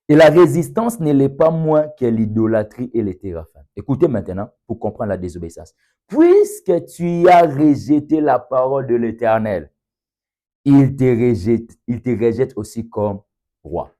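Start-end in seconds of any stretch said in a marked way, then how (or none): 0.1-3.6 loud, raised-pitch proclamation of a verse; forceful scripture reading. Real, not acted. Preacher reading Samuel to the congregation, loud proclamation. 6.1-13.9 loud, raised-pitch proclamation of a verse; forceful scripture reading. Real, not acted. Preacher reading Samuel to the congregation, loud proclamation.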